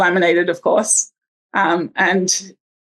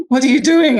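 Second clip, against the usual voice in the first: higher, louder startled "what are you doing"; alarmed question; 6 semitones higher, 5 dB louder than usual. Retold or imitated. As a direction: catch her off guard, alarmed. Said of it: "she's like".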